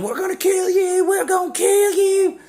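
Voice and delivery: high, loud shouted threat; full-volume, strained high yell. Retold or imitated.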